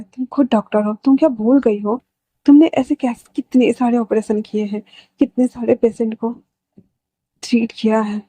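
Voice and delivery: plain reading voice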